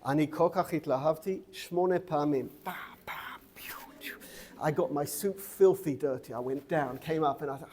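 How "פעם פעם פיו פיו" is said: hushed mimed action noises; whispered sound effects. Shown as a demonstration. Teacher acts out bowing eight times, whispered sound effects. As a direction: whisper little puffs of repeated motion.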